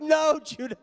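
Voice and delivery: high, loud panicked "No, Judah" with a laugh; panicked cry. Retold or imitated. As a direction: call out to the son in laughing alarm. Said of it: "I'm like".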